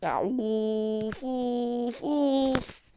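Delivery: slow stretched frustrated of of of; drawn-out groan. Real, not acted. Gamer groaning slowly after a missed chance.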